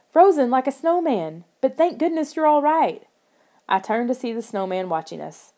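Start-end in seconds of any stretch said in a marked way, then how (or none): 0.1-3.0 high-pitched, gushing relief; relieved excitement. A narrator's reading. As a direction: exclaim it to a rescued friend. Relieved friend after the rescue.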